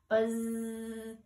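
long droning buzz like a dryer finishing. A narrator's reading. Honeybee buzzing like a finished dryer. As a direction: drone it out like a machine. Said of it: "just like a dryer when it's done drying".

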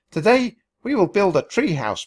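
loud, high barked order; drill sergeant. A narrator's reading. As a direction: command the recruits.

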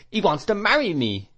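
high-pitched indignant exclamation; voiced servant's outcry, pitch jumping up; crying out. A narrator's reading. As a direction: blurt it out in scandalised protest. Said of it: "cried Peggotty".

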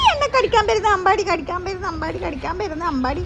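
high singsong teasing, fading down; playful singsong scolding. Real, not acted. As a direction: tease the little biter in a rising chant. Mother playfully scolding biting son.

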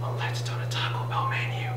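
hushed conspiratorial aside; whispered aside. Acted. Teacher whispering a joke to the viewers.

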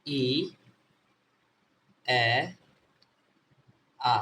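ই, এ, আ as separate long vowels; three vowel sounds. Shown as a demonstration. Teacher showing the three sounds of E, drawn out one by one. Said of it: "ই এর উচ্চারণ তিন রকম".

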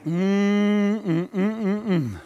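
long soft "mm", then short pulses. Real, not acted. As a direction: moan it softly after the climax. Preacher hums after climax.

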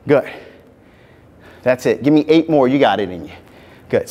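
upbeat coaching voice; aerobics instructor. Acted. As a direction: coach the class through the moves. Fitness class coaching.

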